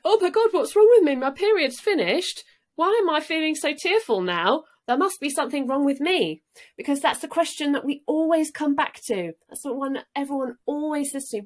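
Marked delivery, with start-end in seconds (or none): 0.0-6.4 high, loud, flustered past self; retold panic. Past self questioning tearfulness after her period, mock panic. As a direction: voice your old alarmed self, pitched well up.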